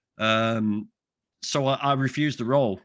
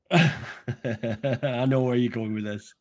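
laughing it out, slow; amused slow laugh while speaking; much slower than usual. Real, not acted. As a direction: chuckle through the line, drawn out. Laughs at teasing question.

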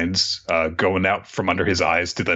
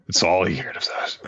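normal talking voice

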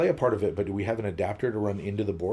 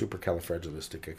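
fast big word; 3 semitones lower, 7 dB quieter, much faster than usual. Shown as a demonstration. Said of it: "I'm saying big words".

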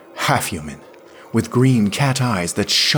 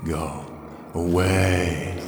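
a voice that is not his own; possessed Smith dismissing Yarrow; not Smith's voice; 4 dB quieter, much slower than usual. A narrator's reading. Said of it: "said the voice that was not Smith's".

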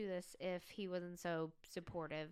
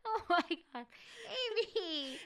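high, loud, delighted disbelief; scandalized exclamation; 9 semitones higher, 10 dB louder than usual. Real, not acted. Cohost reacting to friend's pay prank, scandalized shouting. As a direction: really exclaim at your friend's nerve.

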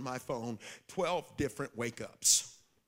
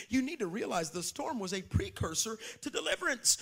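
ordinary delivery

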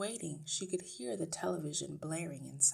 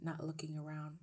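plain reading voice

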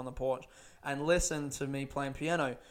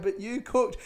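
bemused mum; 6 semitones higher, 4 dB louder than usual. Retold or imitated. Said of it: "my mum would just bemused be like".

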